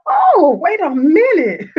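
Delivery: high, loud startled Oh, then a slow wait a minute; retold shocked double take; sudden realization. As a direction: catch yourself in disbelief mid-thought. Realizing boss won't help.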